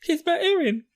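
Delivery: high-pitched pleading voice; Mikasa. Retold or imitated. Mikasa pleading for Eren, in a high put-on voice. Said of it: "even Mika's is just like".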